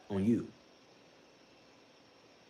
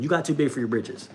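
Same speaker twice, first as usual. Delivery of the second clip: ordinary talk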